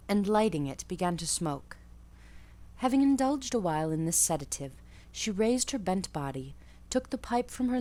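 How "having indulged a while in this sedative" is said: usual speaking voice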